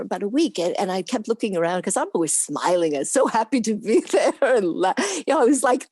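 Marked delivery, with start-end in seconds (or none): none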